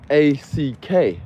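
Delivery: A C K spelled slowly, letter by letter, loud. Shown as a demonstration. Spelling the commenter's A C K back at the camera. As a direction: spell out A C K deliberately. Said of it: "they wrote in the comment section".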